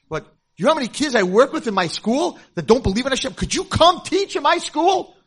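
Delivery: high, loud and eager; excited pitch. Retold or imitated. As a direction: sell her the idea with excitement. Excited invitation to the girl.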